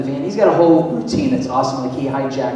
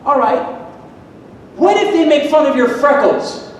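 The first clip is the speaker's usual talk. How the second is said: testing dad; 9 semitones higher, 5 dB louder than usual. Retold or imitated. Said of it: "I'm like".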